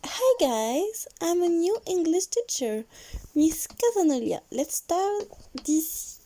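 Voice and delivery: hammed-up French accent on classroom English; French-accented English teacher. Teacher greets the class in English, heavy French accent. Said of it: "bonjour mon accent".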